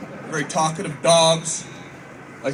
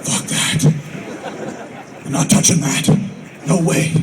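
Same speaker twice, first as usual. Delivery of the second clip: disgusted refusal; slower than usual. Retold or imitated.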